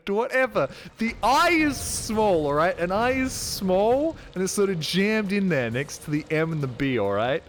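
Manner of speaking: defensive rant. Real, not acted. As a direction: argue your case, worked up. Defending his mispronunciation, raised voice.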